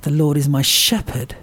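"shepherd" given the main weight; verse landing firmly on the word "shepherd"; stress on shepherd. Shown as a demonstration. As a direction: save the emphasis for "shepherd". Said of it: "Then I emphasise the word".

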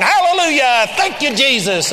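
high, loud mock hallelujah; mimicked praise shout. Unbelievers on the court echoing him, imitated praise shout. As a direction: cry out praise in imitation, well above talking pitch. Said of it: "Here was unbelievers going".

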